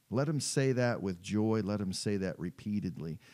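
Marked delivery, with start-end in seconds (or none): none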